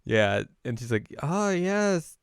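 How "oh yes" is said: high, loud, drawn-out fake agreement; awkward bluffing. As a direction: overdo the nervous agreement, stretching the words. Setsuna sweating, faking being fan.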